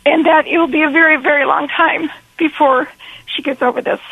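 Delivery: pushing words out through real tears; holding back tears. Real, not acted. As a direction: voice breaking high, fighting tears. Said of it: "I'm gonna try not to cry".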